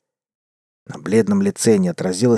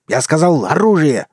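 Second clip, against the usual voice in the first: loud, raised bark; barked order; 6 semitones higher, 4 dB louder than usual. A narrator's reading. The wolf barks at Sandy for the guns. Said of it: "рявкнул волк".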